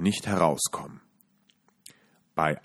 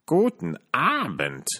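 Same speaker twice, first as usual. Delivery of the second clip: put-on higher, slow, rasping old witch; 7 semitones higher than usual. A narrator's reading. Witch greets the strangers with fake friendliness. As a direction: rasp a falsely friendly greeting. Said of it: "schnarrte sie und tat ganz freundlich".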